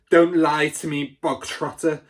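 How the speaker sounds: loud, harsh bark with "Bogtrotter" snapped out. A narrator's reading. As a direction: snap the name like a whip. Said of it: "barked the Trunchbull".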